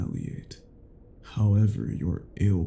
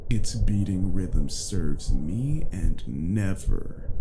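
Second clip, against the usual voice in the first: ordinary delivery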